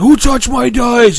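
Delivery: high, loud, drawn-out yell; mock enraged holler; imitated angry screaming. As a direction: mimic a furious player yelling at the table. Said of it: "started screaming at everyone".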